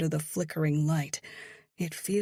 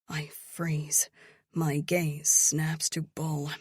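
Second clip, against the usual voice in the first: ordinary delivery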